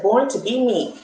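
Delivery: usual speaking voice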